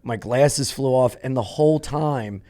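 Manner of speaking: ordinary delivery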